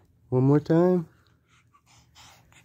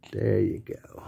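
whisper; 3 semitones lower, 4 dB quieter than usual. Real, not acted. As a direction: breathe it without voice, hushed. Soothing baby with binky.

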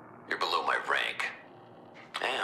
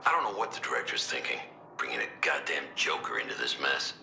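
ordinary delivery

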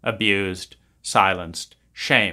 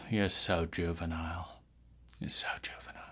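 fading mock whisper; 7 semitones lower, 14 dB quieter than usual.

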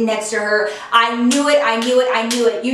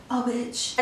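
muttered under breath; 10 dB quieter than usual. Retold or imitated. Retelling spotting the girl, muttering under her breath. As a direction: mutter it so only you hear.

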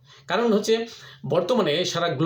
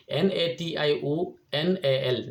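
slow letter by letter N A T I O N A L; spelling out; much slower than usual. Shown as a demonstration. Vlogger spelling the English word for viewers, slow letter by letter. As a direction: spell each letter out slowly for learners. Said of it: "ইংরেজিতে কীভাবে লিখি".

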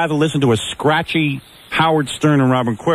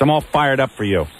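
usual speaking voice

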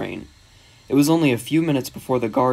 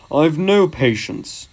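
slow, huffy engine voice; cross; 3 semitones higher, 3 dB louder, slower than usual. A narrator's reading. Gordon complaining about the other engine, cross. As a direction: draw it out with irritation. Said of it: "said Gordon crossly".

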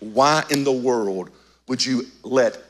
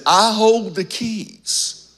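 slow emphatic; 6 semitones higher, much slower than usual. Acted. Repeats Jesus's declaration.

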